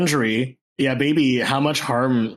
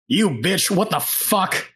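higher, drawn-out yell with little added volume; half-held-back outraged yell; mock yell; 6 semitones higher, slower than usual. Wounded character cursing at the shooter, mild acted yell. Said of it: "I do wanna yell out".

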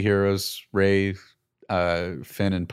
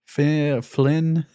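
higher-pitched, hesitant mock droid accent; C-3PO accent; 6 semitones higher than usual. Droid guessing at a friend's name, lightly imitated C-3PO accent. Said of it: "This is the accent that C three P O has".

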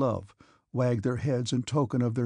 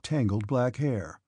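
ordinary delivery, nothing marked

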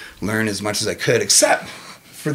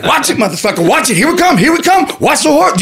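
high, loud, cocky chess taunting; trash talk; 9 dB louder than usual. Retold or imitated. Taunting his chess opponent mid-game, trash talk. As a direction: crow it loudly, high-pitched and laughing. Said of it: "where I started to talk shit".

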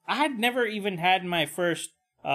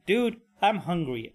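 ordinary talk, nothing marked

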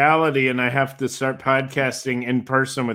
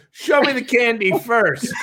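laughing imitation; 8 semitones higher than usual. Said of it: "Vanessa goes".

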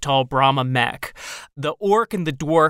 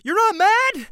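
high disbelief. Acted. NPC skeptical reply.

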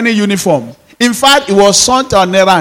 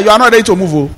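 ordinary talk

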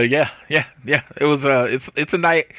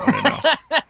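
sing-song high tease; high teasing repeat; 13 semitones higher than usual. Shown as a demonstration. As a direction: repeat it much higher, teasingly. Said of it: "just throwing that out there".

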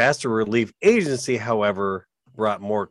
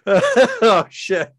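after laughing hard; 12 semitones higher, 4 dB louder, much slower than usual. Real, not acted. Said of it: "Oh, my stomach hurts".

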